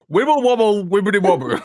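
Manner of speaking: "Wibble wobble wibbly wobble" with w for every r; mock Jonathan Ross saying w for r; says w for r. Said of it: "he's like".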